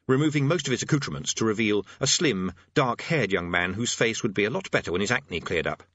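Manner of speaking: plain reading voice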